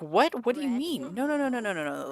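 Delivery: high, startled protest; acted-out panicked refusal; shocked disbelief. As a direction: blurt it out high, sliding down through the denials. Dad reacts to custody news.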